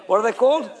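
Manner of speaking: ordinary delivery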